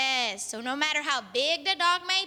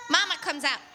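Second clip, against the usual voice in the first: ordinary delivery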